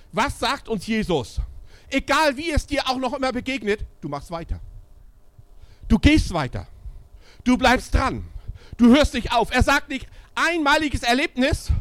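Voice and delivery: high, loud rallying preaching; exhorting preaching. Acted. Preacher urging believers to keep going, loud exhortation. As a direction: push them on, don't let up.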